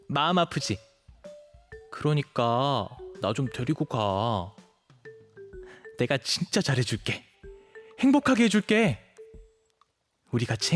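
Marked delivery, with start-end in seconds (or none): 1.9-4.5 slow, drawn-out puppy plea; pleading whine. Acted. Puppy boy begging to be taken home, wheedling. 8.0-9.0 very high, eager plea; eager promise. Acted. Puppy boy begging to be adopted, eagerly promising happiness.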